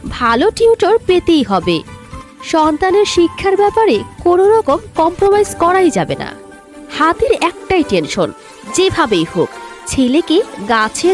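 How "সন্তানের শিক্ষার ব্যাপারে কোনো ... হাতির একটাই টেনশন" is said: ordinary delivery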